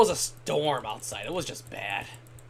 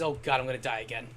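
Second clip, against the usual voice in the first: ordinary talk, nothing marked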